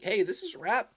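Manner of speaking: high, rousing ringside call-out; playful fight challenge. Pretend. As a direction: egg them on to a mock brawl. Voices rap's challenge to a fight.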